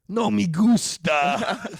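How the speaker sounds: slow, high, loud Spanish punchline; hammed-up drawn-out declaration; punchline exclamation. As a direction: belt out the pun, stretching it. Delivers bilingual pun as punchline.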